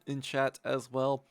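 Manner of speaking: usual speaking voice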